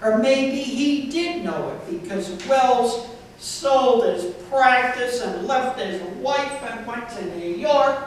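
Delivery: deadpan comic poetry recital with deliberate phrasing; poem reading. Acted. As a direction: read it out line by line to an audience, punching key words.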